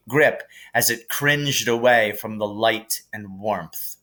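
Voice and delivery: usual speaking voice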